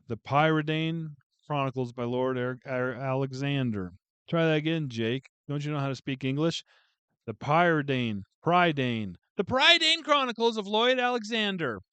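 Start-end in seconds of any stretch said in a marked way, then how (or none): none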